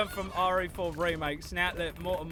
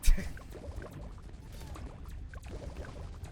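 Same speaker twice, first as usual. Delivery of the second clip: breathy laugh. Real, not acted. Laughing after ghost voice.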